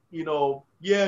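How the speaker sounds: ordinary delivery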